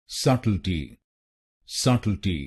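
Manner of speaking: subtlety said as "suttle-tee", silent b; correct pronunciation. Shown as a demonstration. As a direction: say subtlety as "sut-el-tee" with no b sound. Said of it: "इसकी सही pronunciation है".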